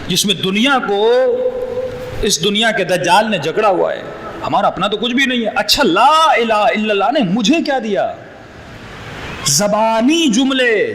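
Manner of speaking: ordinary talk, nothing marked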